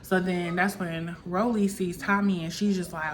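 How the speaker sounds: ordinary talk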